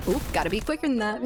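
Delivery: ordinary talk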